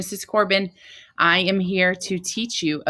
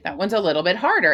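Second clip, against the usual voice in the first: usual speaking voice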